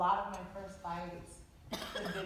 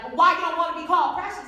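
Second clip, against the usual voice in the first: loud, high challenging voice; demanding an answer; pushy questioning; 8 semitones higher, 14 dB louder, faster than usual. Retold or imitated. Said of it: "if they're like".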